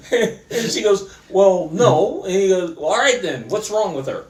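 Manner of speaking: laughing while retelling. Real, not acted. Retelling punchline while amused.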